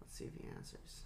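whispered, low and hushed; whispering. Real, not acted. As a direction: whisper it while waiting. Waiting for the call.

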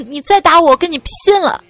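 hammed-up fed-up outburst; mock outburst. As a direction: act out snapping at last, pitch climbing. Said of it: "终于有一天爆发了".

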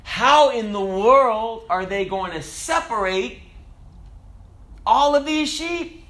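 high, slow, loud disbelief; baffled tourist. Acted. Tourist filming mingled flocks, loudly incredulous. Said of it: "he goes".

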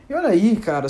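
exasperated owner scolding a messy pet. Pretend. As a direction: tell off a pet who wrecked the flat. Scolding the tiger for the mess.

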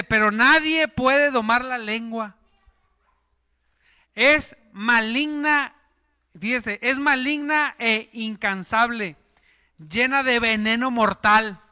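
solemn preacher's reading; scripture reading. Acted. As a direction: read the verse with weight. Reads scripture.

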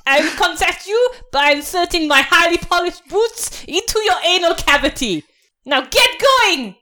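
high-pitched, irate officer threat; General Hans. Acted. General threatening a soldier at the checkpoint, irate and high. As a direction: order them off like a pompous martinet.

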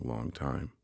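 ordinary delivery, nothing marked

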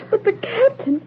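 high, tearful, broken protest; distraught. Acted. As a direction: break down while protesting. Daughter distraught over the captain.

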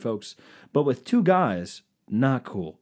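normal talking voice

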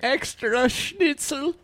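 high, slow hammed-up German accent. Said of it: "starts talking like a German".

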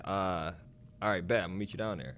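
low, soft friend impression; Keith. Imitating friend confirming a meetup, low and soft. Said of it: "this is my Keith voice also".

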